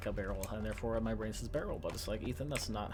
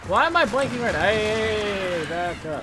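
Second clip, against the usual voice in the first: loud exasperated yelling; frustrated shout; 8 semitones higher, 10 dB louder than usual. Real, not acted. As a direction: yell it out in frustration. Frustrated, then yelling at birds.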